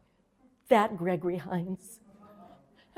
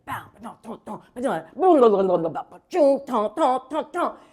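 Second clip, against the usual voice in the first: scat rhythm; 6 dB louder than usual. Retold or imitated.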